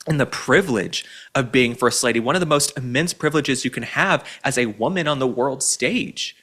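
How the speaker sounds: normal talking voice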